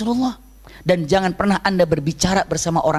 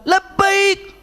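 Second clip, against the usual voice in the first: high, yelled answer to Mother; acted son answering too forcefully; too-loud reply; 13 semitones higher than usual. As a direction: shout back from far away. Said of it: "Tadi saya jawabnya terlalu keras".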